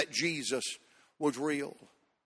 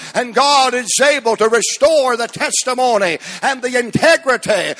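loud, high, ringing climax; preaching at full force; 5 semitones higher, 7 dB louder than usual. Acted. Preacher rallying the church at the sermon's peak, full force. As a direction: build to a shouting climax.

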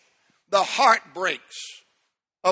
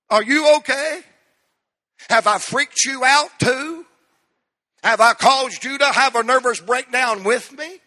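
raised, higher-pitched retold questions; alarmed questioning; 6 semitones higher, 6 dB louder than usual. Preacher retells asking his crying friend if he's okay, alarmed questioning. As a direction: press the questions louder and higher, mock alarm.

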